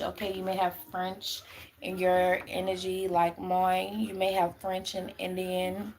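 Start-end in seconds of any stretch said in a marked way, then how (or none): none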